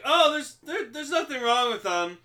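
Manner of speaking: high, airy dismissal; mock doctor reassurance. Doctors dismissing the patient's trouble, mock reassurance.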